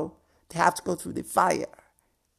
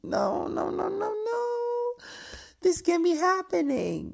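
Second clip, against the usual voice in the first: dramatic dismay; 14 semitones higher than usual. Retold or imitated. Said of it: "I was like".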